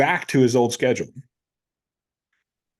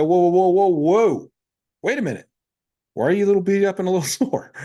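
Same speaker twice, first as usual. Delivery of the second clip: higher, halting run of whoas, then quick questioning; alarmed interruption; 6 semitones higher than usual. Retold or imitated. Coach stopping an overtrained lifter, alarmed. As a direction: pull someone up short in mock alarm.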